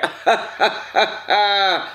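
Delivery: high delighted laugh. Real, not acted. Excited laugh at his favorite food.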